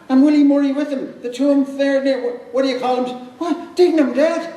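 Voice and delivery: high, stammering disbelief at the news; sputter, unable to take it in; shocked disbelief. Acted. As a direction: Alf reeling that his friend is dead.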